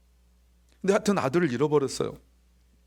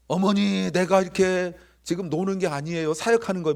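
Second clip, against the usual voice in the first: mock protest to mum, higher and louder; child protesting; 5 semitones higher, 4 dB louder than usual. Young Jesus answering his mother's call, mock protest. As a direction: protest like a child interrupted at play.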